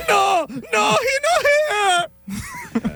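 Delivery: put-on high wailing old grandma shout; haunting voice. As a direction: screech it like a spooky abuela. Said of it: "eerie impression of my grandmother".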